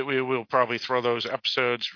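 ordinary delivery

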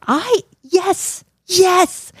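high-pitched, drawn-out cheering; gleeful triumph. Real, not acted. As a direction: crow with delight over a small victory. Celebrates partner missing the twist.

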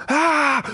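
high-pitched mock scream; mimicked shriek from the cellar; imitated scream. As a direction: let out a sudden high scream, retelling it.

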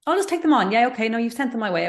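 high, eager people-pleaser. Retold or imitated. Mocking her own people-pleasing habit, high and eager. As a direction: chirp it like you can't say no.

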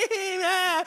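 high squealing mock sob; mock kid wail. Imitating a child's ugly cry, high mock wail.

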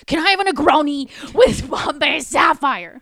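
high, full-volume mock bellow; imitated angry shout. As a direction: yell the order as the fed-up customer. Mumbler finally blows up at bartender.

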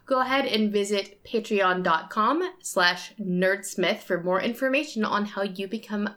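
usual speaking voice

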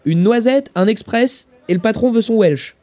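high, loud waiter's call; calling out orders. Acted. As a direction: call orders across a busy café.

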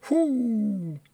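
high, drawn-out falling whoo; long relieved whoo. Real, not acted. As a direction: let out a long sliding whoo after laughing. Winding down after laughing fit.

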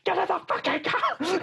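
mock yell. Player yelling at character in car.

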